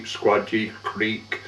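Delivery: ordinary talk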